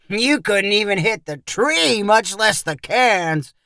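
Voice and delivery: drawn-out mocking sneer; taunting. A narrator's reading. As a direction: jeer at a poor shot, dragging it out. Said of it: "Perry said tauntingly".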